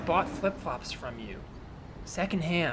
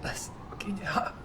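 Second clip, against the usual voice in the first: whisper; 4 semitones higher, 6 dB quieter than usual. Acted. Aside to girlfriend.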